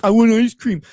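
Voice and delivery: loud mock child's demand; imitated whining kid. Man imitates kid taken to get cake, loud demanding. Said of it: "He's like the Down syndrome kid".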